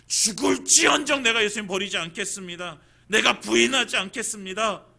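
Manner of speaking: loud, firm vow of loyalty; Peter's vow. Acted. (베드로처럼 힘차게 크게 맹세하듯)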